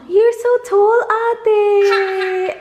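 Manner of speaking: high, bright sing-song praise. Real, not acted. Mother praising her daughter for standing tall. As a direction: cheer her on sweetly.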